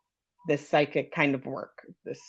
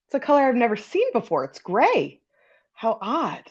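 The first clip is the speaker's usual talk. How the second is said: puzzled; 7 semitones higher than usual. Retold or imitated. Puzzled reading retold.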